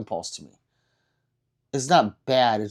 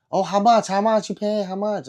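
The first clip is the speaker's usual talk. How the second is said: pushy seller, higher and louder; pushy shopkeeper; 8 semitones higher, 4 dB louder than usual. Retold or imitated. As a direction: press him like an eager street vendor. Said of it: "she goes".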